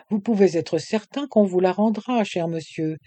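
ordinary delivery, nothing marked

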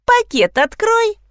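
high, drawn-out order; bratty command. A narrator's reading. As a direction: order it like a needling kid. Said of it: "велел вредный Кирик". (вредным голосом командует)

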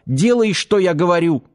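shouted order. Acted. Medic to the doubting Азат, shouting the order. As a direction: snap the order out, half-shouting. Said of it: "заорал".

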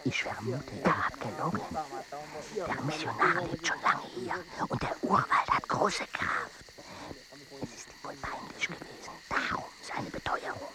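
hushed whisper so nobody overhears. Acted. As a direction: breathe it out as a secret. Secret suspicion told at night.

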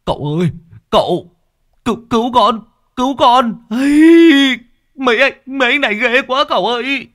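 high, loud panicked wail for help; terrified wailing. Acted. Long, held by the guards, wails to the master in panic. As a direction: scream to the master to be rescued. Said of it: "nó gào lên".

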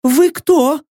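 high voice, screaming subsided; calmer captive voice. A narrator's reading. Prisoner answering after a silence, calmer high voice. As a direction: ask in a high voice, calmer now. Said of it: "он прозвучал уже куда спокойней".

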